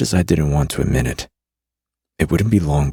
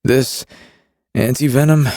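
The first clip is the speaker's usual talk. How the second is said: higher male voice, nauseous; a queasy smuggler; Carrion voice; 11 semitones higher, 4 dB louder than usual. A narrator's reading.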